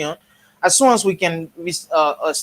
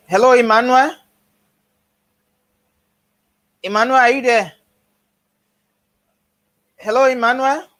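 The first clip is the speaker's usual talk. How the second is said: raised voice calling a name; 7 semitones higher, faster than usual. Real, not acted. Calling out to muted caller.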